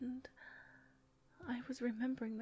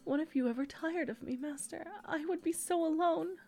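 louder, higher, rising panic; anxious pleading; 4 semitones higher, 7 dB louder than usual. Acted. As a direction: plead harder and harder, frightened.

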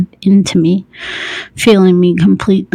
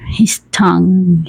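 ordinary delivery

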